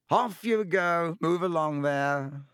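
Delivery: teacher calling to the crowd. A narrator's reading. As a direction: wave the crowd along. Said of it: "Lockhart called to the crowd".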